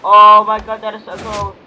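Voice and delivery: loud, thrilled outburst; excited amazement. Real, not acted. Amazed by something cool in game.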